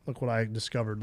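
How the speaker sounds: ordinary talk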